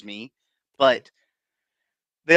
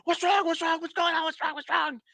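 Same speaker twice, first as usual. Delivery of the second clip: much higher, frantic repeated questioning; panicked parents. Retold or imitated. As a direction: mimic frantic parents bursting in. Parents run upstairs, panicked.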